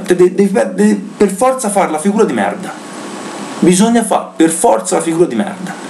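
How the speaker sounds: exasperated high-pitched rant; explosive italian cursing. Real, not acted. Fan ranting after 5-0 loss.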